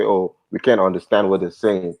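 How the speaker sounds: ordinary delivery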